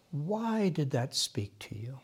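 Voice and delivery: slow hushed whisper to the group. Real, not acted. Guiding the group into meditation, a slow whisper. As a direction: breathe the question softly and slowly.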